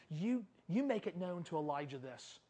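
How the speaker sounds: quiet, low threat; restrained acted anger; irate queen. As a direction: deliver the message softly and low. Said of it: "she gets irate".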